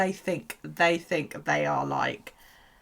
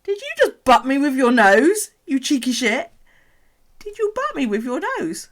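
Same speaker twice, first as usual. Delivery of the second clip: mock outraged shout; 8 semitones higher, 10 dB louder than usual. Real, not acted. Owner mock-scolding her snake for poking her with its nose. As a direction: fake scolding of a cheeky pet.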